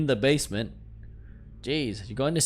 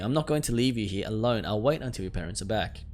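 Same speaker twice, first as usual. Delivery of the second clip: ordinary talk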